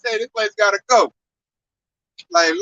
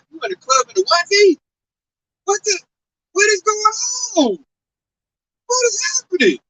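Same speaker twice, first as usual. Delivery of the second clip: mock disbelief; 9 semitones higher than usual. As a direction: act baffled, stretching each question. Shocked at women in onesies.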